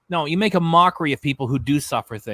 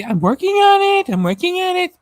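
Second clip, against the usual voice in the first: much higher and louder sing-song mockery; mocking sing-song; 7 dB louder than usual. Ranting vlogger mocks her high sing-song voice. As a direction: parody her lilt, way up high. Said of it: "Hear her say".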